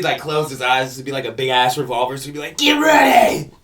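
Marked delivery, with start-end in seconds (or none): 2.6-3.5 Yosemite Sam impression.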